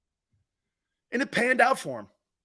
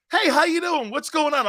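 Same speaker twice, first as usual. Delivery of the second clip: much higher, louder over-cheery hello; friendly Southern greeting; 8 semitones higher, 4 dB louder than usual. Retold or imitated. Imitating Southern strangers greeting a Northerner, high and cheery. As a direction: play the overly friendly stranger. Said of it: "people down south go like this".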